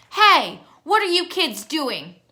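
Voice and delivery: loud, high yell across the yard. A narrator's reading. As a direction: shout at the kids to stop them. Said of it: "a voice yelled".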